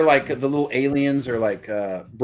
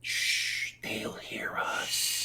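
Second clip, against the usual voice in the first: slow, drawn-out whisper with a long shush; hushed whisper; 11 dB quieter, much slower than usual. Acted. Hushing companions in the Newt scene, whispered. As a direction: whisper it as if hiding from listeners.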